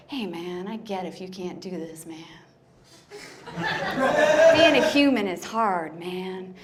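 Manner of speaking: mock laid-back cool dude; super cool. Writing to the ex, acting super cool. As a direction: play it casual and chill. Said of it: "I sounded, like, super cool".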